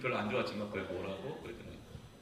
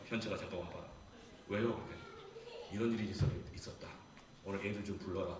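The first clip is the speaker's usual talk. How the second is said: hushed secret order; 7 semitones lower, 8 dB quieter than usual. Retold or imitated. Quietly summoning disciple, secretive plan.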